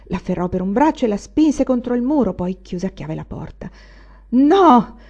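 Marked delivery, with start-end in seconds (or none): 4.3-5.0 loud high shout. A narrator's reading. Anania shouting at the weeping mother. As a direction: yell the no at her. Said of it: "egli gridò".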